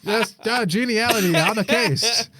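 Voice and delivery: high, loud giddy laughter through the words; cracking up at the new character; laughing. Real, not acted. Host cracking up at the typo-born character, laughing high.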